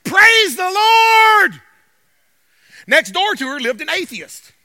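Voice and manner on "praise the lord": loud, slow, drawn-out joyful shout; shouted praise. Retold or imitated. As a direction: belt it out to the street. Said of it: "she would be like".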